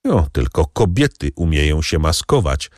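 ordinary delivery

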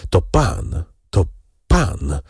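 halting, broken-up words with pauses; a touch of fearful faltering; frightened stammer. A narrator's reading. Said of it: "wyjąkał młodzieniec".

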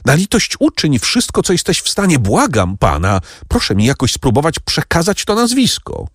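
high, urgent appeal; pleading. A narrator's reading. Franz begging Noirtier for the name, pleading. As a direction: beg desperately, pitched well up.

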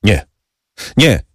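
short, mildly exclaimed protest; exclaimed refusal. A narrator's reading. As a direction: refuse outright, in brief separate bursts. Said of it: "wykrzyknął Bertuccio".

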